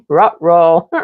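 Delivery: cartoon dog "rut row" with a laugh. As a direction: do the cartoon dog's "uh oh". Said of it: "one of my favorite things to say".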